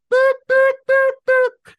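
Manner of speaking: high mock beeps; beeping noise. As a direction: beep like an alarm. Alarm beep imitation.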